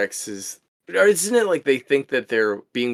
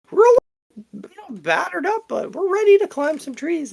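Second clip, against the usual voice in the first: put-on chipper movie kid; 3 dB louder than usual. Mocking the movie kids' cheerfulness.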